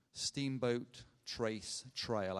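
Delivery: each word slow and set apart; deliberate, drawn-out pronunciation with small gaps; slow and careful. Real, not acted. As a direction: say the name slowly, word by word. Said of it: "I had to say that slowly".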